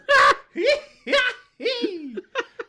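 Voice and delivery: shrill wheezing hee-hee; high prospector cackle. Pretend. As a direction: cackle like a gold-mad old miner. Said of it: "my old prospector voice".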